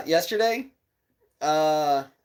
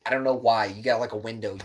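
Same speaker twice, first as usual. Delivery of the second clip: normal talking voice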